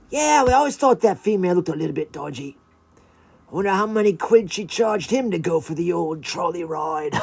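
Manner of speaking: loud put-on creature gossip voice; joking iguana. Iguana joking about a dodgy female, put-on voice. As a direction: rib a mate in iguana voice.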